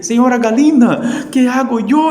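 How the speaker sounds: high, loud squeaky pleading mouse voice; desperate mouse. Acted. Preacher as desperate mouse begging the hen, high and pleading. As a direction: beg the hen for help, high and frantic. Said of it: "fue a conversar con la señora Galina y dice".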